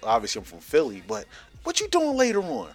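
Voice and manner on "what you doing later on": high falsetto invite; mock flirty woman. Atlanta woman at baggage claim flirting, high mock voice. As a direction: coax like a forward admirer.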